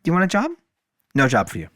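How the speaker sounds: lightly mock the job seeker in an imagined voice; playfully voiced Spirit. The Spirit teasing him about wanting a job, playful. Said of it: "the spirit was like".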